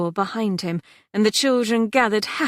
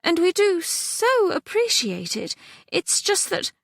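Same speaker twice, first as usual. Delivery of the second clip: much higher, halting and nervous; flustered mother placating the coalman; faltering; 11 semitones higher than usual. A narrator's reading. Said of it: "said Mamma falteringly".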